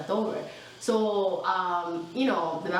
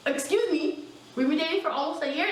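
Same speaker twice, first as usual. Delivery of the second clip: indignant; 8 semitones higher than usual. Retold or imitated. Outraged at cheating discovery.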